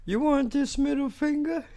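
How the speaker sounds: very high put-on cartoon voice; cartoon character impression. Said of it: "He says something like that".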